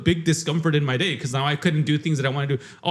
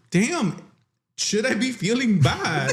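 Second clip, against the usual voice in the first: higher, slower, amused question; mock-worried question, pitched up and laughing; laughing self-doubt; 6 semitones higher, slower than usual. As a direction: wonder aloud while chuckling.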